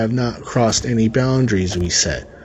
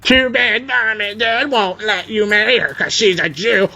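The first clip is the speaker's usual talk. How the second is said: high mocking sneer, pitched way up; mocking whiny voice; 9 semitones higher than usual. Narrator mocking his brother's taunt, high and whiny. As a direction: mimic him like a whiny brat. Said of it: "the malicious tone in which he said it".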